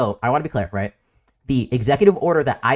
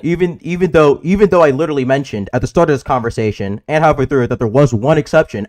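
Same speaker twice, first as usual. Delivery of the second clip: louder, frustrated insistence; loud exasperated; 7 dB louder than usual. Real, not acted. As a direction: talk over them, exasperated. Frustrated shouting back at mockery.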